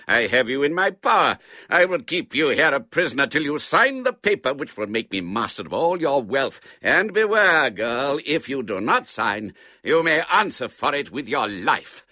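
loud, menacing melodrama villain; sneering villain. A narrator's reading. Man demanding his prisoner sign away her wealth, sneering. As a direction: threaten your captive with a loud sneer. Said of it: "sneered the man".

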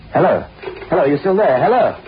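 calling down phone. Acted. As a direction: check urgently if he's still on the line. Phone line has gone dead.

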